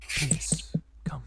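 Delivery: frail whispered plea; weakened mayor. Acted. Weakened mayor inviting visitors to follow, faint whisper. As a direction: whisper it with little strength left. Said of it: "he seems a lot weaker".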